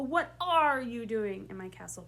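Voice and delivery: "are" punched out loud; stress on are. Shown as a demonstration. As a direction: hit "are" hard, then let the line fall. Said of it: "pick one word in that sentence to really emphasize".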